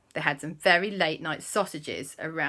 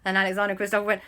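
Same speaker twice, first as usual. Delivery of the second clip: plain reading voice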